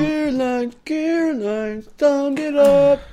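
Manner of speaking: high, slow, loud mock plea; high silly voice. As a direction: wail it in a falsetto. Mock high sung call.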